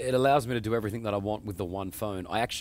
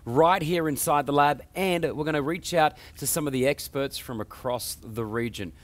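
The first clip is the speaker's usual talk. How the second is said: high, loud show-opening delivery; punchy, energetic host intro hyping the show; upbeat TV presenter; 5 semitones higher, 5 dB louder than usual. Acted. Host welcomes viewers to the tech show, upbeat presenter.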